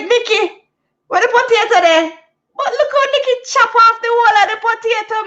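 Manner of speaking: high, fast teasing friend; friend's accent. Retold or imitated. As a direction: mimic the friend's surprise.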